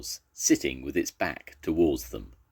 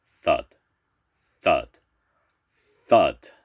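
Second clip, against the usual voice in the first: loud thuds, growing louder; approaching footsteps; 8 dB louder, much slower than usual. A narrator's reading. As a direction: stomp each word, spaced like giant steps. Narrator imitates father's approaching footsteps.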